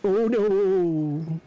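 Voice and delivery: high, drawn-out wail of dismay; real alarmed exclamation; dismayed cry. Real, not acted. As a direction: cry out as the game goes wrong. Dismay during the game.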